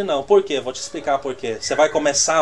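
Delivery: ordinary talk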